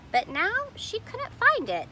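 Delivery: plain reading voice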